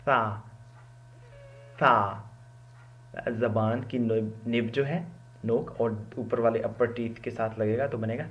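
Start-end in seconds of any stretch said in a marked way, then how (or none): 0.1-2.2 "سا" with the tongue tip on the upper teeth. Shown as a demonstration. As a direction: hiss "سا" lightly off the upper teeth. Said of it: "تو سا کا گھر کیا ہے".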